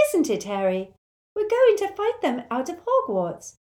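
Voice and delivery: cheerful Luna climbing very high; bright. A narrator's reading. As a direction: chirp it brightly, pitch soaring at the end. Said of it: "said Luna brightly".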